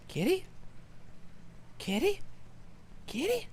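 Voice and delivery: very high, soft rising call; coaxing a cat. Real, not acted. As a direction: sweetly coax the kitty out.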